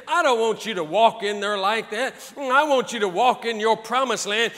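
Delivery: ordinary talk, nothing marked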